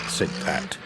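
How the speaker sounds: plain reading voice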